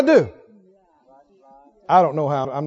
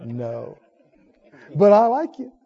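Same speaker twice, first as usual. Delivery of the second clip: kind decline with a laugh; gentle no, warm laugh; smiling refusal; slower than usual. Retold or imitated. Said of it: "smile and go".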